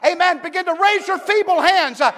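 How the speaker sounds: loud, high preaching shout; preacher urging the weary congregation to church; preaching at full force. Acted.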